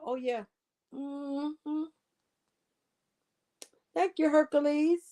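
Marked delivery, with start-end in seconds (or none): none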